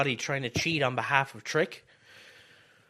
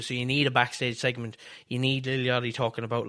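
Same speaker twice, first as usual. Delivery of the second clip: normal talking voice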